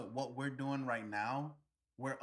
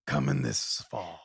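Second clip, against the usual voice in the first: much lower, booming trailer announcer; deep epic voice-over for a film trailer; movie trailer voice; 10 semitones lower, 5 dB louder than usual. Acted. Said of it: "that was good".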